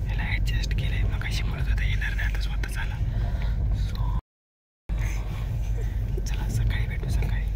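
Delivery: whispering at night so others sleep; hushed whisper in a sleeping train; quiet at night. Real, not acted. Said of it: "मस्तपैकी झोपलोय बघा आम्ही".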